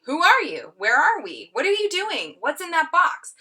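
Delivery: a barrage of nervous questions; pushy questioning. Acted. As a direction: pepper them with anxious questions.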